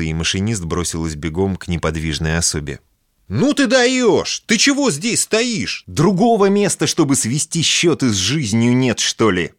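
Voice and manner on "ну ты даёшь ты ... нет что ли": shouting. Acted. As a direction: yell at her in disbelief. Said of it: "кричала".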